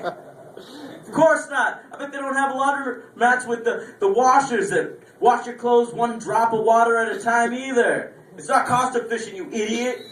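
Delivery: high sarcastic mockery of the friend; sarcastic rant.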